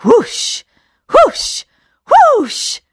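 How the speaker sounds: magic whooshing; rising magic whoosh. Acted. Magic spell effect.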